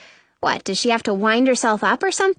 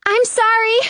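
high-pitched mild yell; calling out; 10 semitones higher, 3 dB louder than usual. A narrator's reading. Snow to the girl through the curtains, calling out. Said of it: "Snow yells through the curtains".

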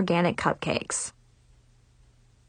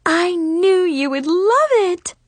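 higher, slow, delighted gush; 9 semitones higher, 4 dB louder, slower than usual. A narrator's reading. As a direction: gush happily over the praise. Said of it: "Mom gushed happily".

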